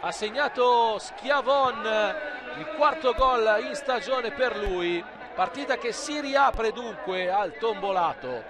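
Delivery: quick, rising live match call; breathless italian goal commentary. Real, not acted. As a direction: ride the action breathlessly, building to the goal.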